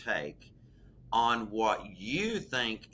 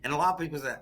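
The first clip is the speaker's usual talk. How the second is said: usual speaking voice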